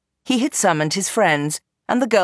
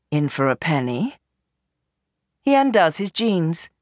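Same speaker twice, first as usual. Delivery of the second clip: ordinary delivery, nothing marked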